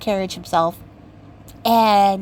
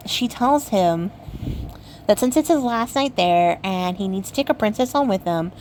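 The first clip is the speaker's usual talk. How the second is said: usual speaking voice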